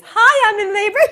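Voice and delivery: high, loud chirpy greeting; hammed-up giddy squeal; big-smile cheer. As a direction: beam it out, absurdly happy. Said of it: "with a big smile on your face".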